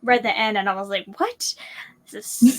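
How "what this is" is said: mock stunned reaction, half laughing; shocked disbelief. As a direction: relive the jolt of reading the ending. Retold reaction to the ending.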